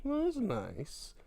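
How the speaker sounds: very high soft sarcastic approval; high slow sarcastic "Nice"; falsetto mock nice. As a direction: squeak out fake politeness. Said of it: "I'm like".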